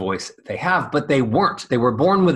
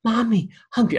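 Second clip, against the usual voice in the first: put-on high, breathy little kid; airy child voice; 7 semitones higher than usual. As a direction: whine it airily like a toddler. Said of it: "I would speak airy".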